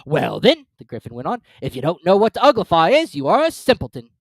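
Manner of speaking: high, loud gruff creature; gruff Gryphon. Acted. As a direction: bluster like a rough beast.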